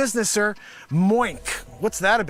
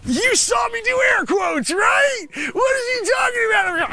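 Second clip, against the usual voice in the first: much higher, loud indignant yelling across the room; exasperated shout; 13 semitones higher, 5 dB louder than usual. Acted. Shouting high and loud at Cubes over the air-quotes joke. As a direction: yell it up high like you can't believe they missed the joke.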